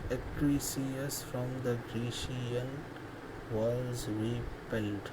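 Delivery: very slow, low and soft; sounding out syllables. Real, not acted. Teacher checking the verse's syllables, quietly to oneself. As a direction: measure out each syllable under your breath.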